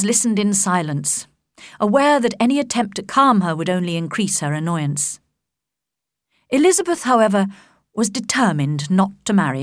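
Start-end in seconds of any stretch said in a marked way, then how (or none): none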